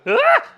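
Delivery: sudden high squeal of a startled kobold; shrill kobold shriek. Acted. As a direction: yelp in panic, way up high. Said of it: "and the kobold just goes".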